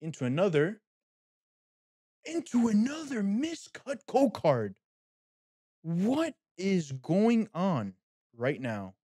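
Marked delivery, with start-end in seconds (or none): 2.2-4.8 high, slow, drawn-out disbelief; incredulous. Real, not acted. Card opener hits another miscut, drawn-out high disbelief. 5.8-8.9 very slow, drawn-out bewilderment; baffled. Real, not acted. Card opener baffled by miscuts, slow drawn-out bewilderment.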